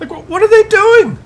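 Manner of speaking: what on earth are they doing; incredulous cry. Real, not acted. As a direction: caster baffled by the players. Incredulous at replay.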